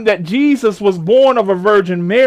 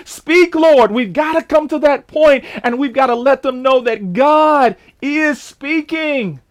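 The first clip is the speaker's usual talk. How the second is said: raised, high-pitched cry to the Lord; urgent, lifted pulpit proclamation; impassioned preaching; 4 semitones higher than usual. Real, not acted. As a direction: ring it out to the congregation, slowing at the end. Preacher calling out to God.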